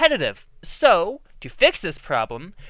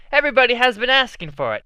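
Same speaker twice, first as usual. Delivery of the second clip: usual speaking voice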